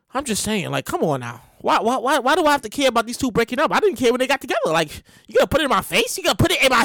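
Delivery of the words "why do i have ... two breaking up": ordinary delivery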